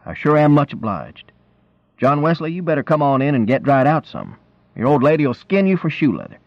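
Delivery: lightly voiced rural man giving neighborly advice; friendly country man. A narrator's reading.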